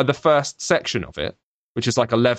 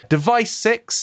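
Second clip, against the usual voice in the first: announced title; 10 semitones higher, slower than usual. Real, not acted. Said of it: "You have to shout that".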